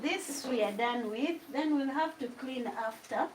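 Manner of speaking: normal talking voice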